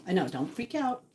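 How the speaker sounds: ordinary talk, nothing marked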